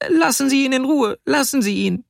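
put-on very high, distressed little girl; high-pitched tearful protest; nearly crying. A narrator's reading. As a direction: plead as a child close to tears. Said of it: "Sie weinte schon beinahe".

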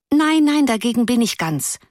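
lightly voiced girl, high and pleading; mild pity in a girl's voice. A narrator's reading. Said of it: "in mitleidigem Ton".

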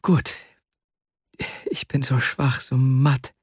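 weakly voiced, mildly breathless; gasping for breath. A narrator's reading. Weary man confessing to his friend how weak he is, mildly breathless. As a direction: pause between phrases as if struggling for air. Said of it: "um Atem ringend".

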